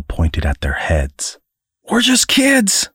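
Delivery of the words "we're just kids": high, drawn-out cry; calling out. A narrator's reading. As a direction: call out pleadingly in a raised pitch. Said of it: "they called in unison".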